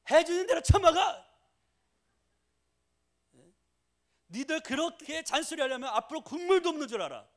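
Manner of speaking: angry mother's shout, high and loud; mom yelling. Retold or imitated. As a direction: blow up at the picky kids like a fed-up mom. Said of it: "막 버럭 소리 질러요".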